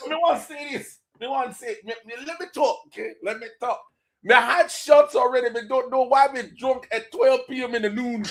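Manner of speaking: tipsy mock Jamaican patois; mock Jamaican accent. Cohost keeps joking in a mock Jamaican accent. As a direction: keep up the Jamaican bit, half laughing. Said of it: "stop trying to stop this goddamn voice".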